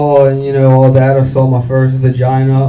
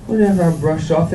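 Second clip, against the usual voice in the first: ordinary delivery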